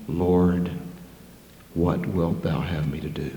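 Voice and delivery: hushed and trembling with awe; humbled Saul. Acted. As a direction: ask it barely above a breath, broken. Saul humbled, asks the Lord.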